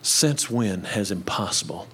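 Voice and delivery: softly spoken lyric sinking to a whisper; soft song lyric. Retold or imitated. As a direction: breathe the lyric out, ending in a whisper. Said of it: "it starts out like this".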